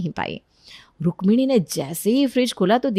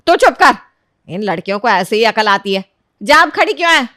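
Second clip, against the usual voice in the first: shouted scolding; 7 dB louder than usual. Acted. Mother-in-law silences son defending his wife, shouting. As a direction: shut him down angrily. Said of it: "जोर से चिल्लाकर बोलती है".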